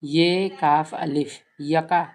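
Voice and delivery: name each Urdu letter slowly; slow letter reading. Shown as a demonstration. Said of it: "दिख रहा है ना".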